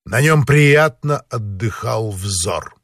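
usual speaking voice

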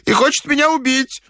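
put-on high-pitched panicky old man; very high, frightened voice; terrified old man. A narrator's reading. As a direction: wail it in mortal fear. Terrified old man tells of pursuer.

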